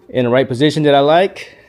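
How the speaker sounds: usual speaking voice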